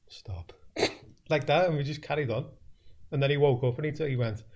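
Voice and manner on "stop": a soft, low, half-asleep "Stop."; sleepy protest. Retold or imitated. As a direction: mimic dad mumbling "Stop." in his sleep. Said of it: "he's going".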